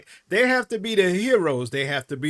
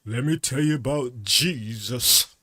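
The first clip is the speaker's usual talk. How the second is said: lightly hammed-up evangelist pitch, lowered; mock missionary; 4 semitones lower than usual. Missionary arriving the first time to convert people, mock preaching in a low voice. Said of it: "when they came in there the first time".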